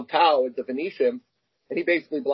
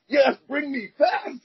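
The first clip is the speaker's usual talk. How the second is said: higher, slow, desperate plea; panicked plea; 10 semitones higher, much slower than usual. Retold or imitated. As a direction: beg the driver in panic. Panicked plea to cab driver.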